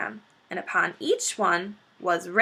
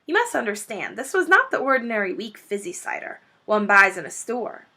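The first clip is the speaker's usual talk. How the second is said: usual speaking voice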